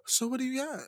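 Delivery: very soft, probing question; imitated wary voice. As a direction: drop to a hush, imitating a wary girlfriend. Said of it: "when they get that, that, that, that voice".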